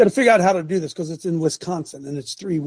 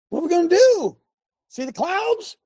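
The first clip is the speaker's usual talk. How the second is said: mock alarm; 10 semitones higher than usual. Real, not acted. Mock alarm over the weather.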